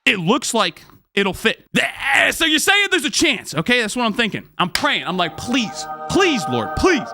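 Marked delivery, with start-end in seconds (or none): none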